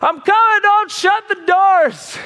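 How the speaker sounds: high, loud call to hold the doors; frantic loud hurry-up yell. Retold or imitated. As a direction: shout to the crew from far off. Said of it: "I hear a man in the distance saying".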